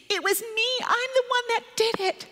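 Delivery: put-on high-pitched, owning-up child voice; confessing child.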